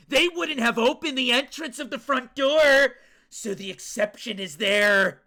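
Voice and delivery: loud, high theatrical proclamation; dramatic declaration. Acted. Reading the front door deduction, theatrical shout. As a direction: belt out the deduction, hammed-up. Character's realization read aloud.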